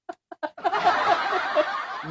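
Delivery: a real, high-pitched laugh that breaks out; high burst of laughter. Real, not acted. Host laughing at the priest who says he's angry.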